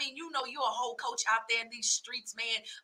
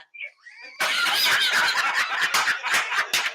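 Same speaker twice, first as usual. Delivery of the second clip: loud laugh; 8 dB louder than usual. Real, not acted. Laughs at boxing joke.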